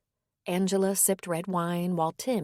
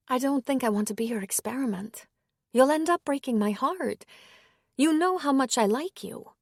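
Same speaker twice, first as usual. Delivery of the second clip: higher, hesitant woman's voice; 6 semitones higher than usual. A narrator's reading. Woman gently declining the priest's invitation, higher voice.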